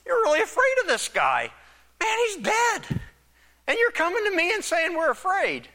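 exasperated Pilate. Retold or imitated. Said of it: "he said".

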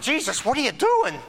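high, loud bewildered protest; alarmed disciples. Acted. Disciples grabbing on to Jesus, alarmed. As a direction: blurt it like a baffled follower tugging at him. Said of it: "crying around and the disciples that are kind of grabbing on".

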